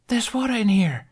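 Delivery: put-on high child voice, a touch excited; a boy announcing his find; young boy. A narrator's reading. The boy Jamie calls out a discovery to Jack.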